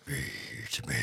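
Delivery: low, eerie cursed-sword voice; growing hunger from an evil blade; soft, low sinister call; sword's voice. Acted. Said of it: "I can hear it".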